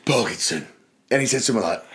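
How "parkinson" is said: low, quiet hard-man teacher; Jason Statham. Retold or imitated. Teacher handing back a failed test, low hard-man voice. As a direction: growl the surname low, mock tough. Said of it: "Like Jason Statham".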